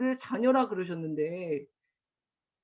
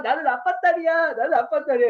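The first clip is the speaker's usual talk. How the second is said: loud, raised-pitch mock-kid chant; imitated gleeful child; 5 semitones higher, 6 dB louder than usual. Dad imitating daughter scattering snacks in the car, gleeful. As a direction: play a daughter proudly carried away. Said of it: "막 웃으면서".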